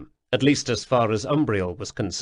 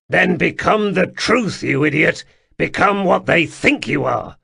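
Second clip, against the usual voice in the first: louder, insistent push; firm urging; 6 semitones higher, 6 dB louder than usual. Acted. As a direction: shake him out of it. Sul urging Atropos firmly.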